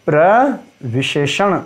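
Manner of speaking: slow, drawn-out term. Shown as a demonstration. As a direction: stretch प्रविशेषण out slowly. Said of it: "तो ये क्या था?". (धीरे-धीरे प्रविशेषण)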